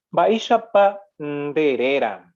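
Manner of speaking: slow, drawn-out Baisha Pandereran; slow model reading. Shown as a demonstration. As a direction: read Baisha Pandereran slowly, stretching the syllables. Teacher reads out Guarani example slowly.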